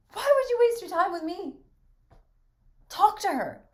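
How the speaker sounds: high, loud bitter shouting; shrieking wounded rage; jealous outburst. Acted. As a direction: hurl the jealousy at him. Bertha bursts out jealously.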